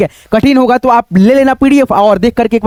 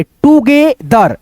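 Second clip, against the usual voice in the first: broken into syllables; much slower than usual. Shown as a demonstration. Teacher sounds out together for learners, slow syllables. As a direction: draw out each syllable of "together". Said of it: "इसको क्या पढ़ेंगे".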